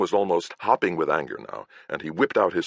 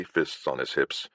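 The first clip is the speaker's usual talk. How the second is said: plain reading voice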